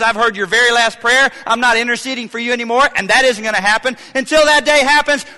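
rising preacher's exhortation, building to shouted prays; preaching. Acted. Preacher urging the church not to lose heart, building fervor. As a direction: preach it with growing fire.

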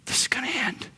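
soft, whispered assurance; hushed whisper. Real, not acted. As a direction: whisper it like a secret promise. Hushed assurance of the finish line.

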